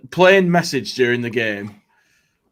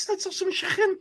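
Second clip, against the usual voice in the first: high, squeaky bad Scouse accent; 5 dB quieter than usual. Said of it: "these horrendous fucking, uh, accents".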